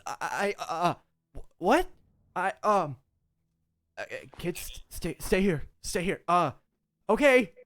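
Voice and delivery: halting, broken-up, scared father, a touch high; terrified stammering father. Acted. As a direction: stutter through it like a cornered parent. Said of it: "he's absolutely terrified".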